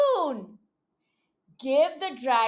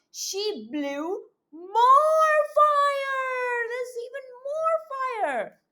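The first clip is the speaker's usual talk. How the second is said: dramatic alarm; 9 semitones higher than usual. Acted. Dramatic worsening fire.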